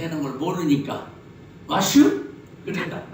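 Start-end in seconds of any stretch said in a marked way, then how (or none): 1.7-2.3 Chungcheong dialect barber. Retold or imitated. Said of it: "충청도 말로".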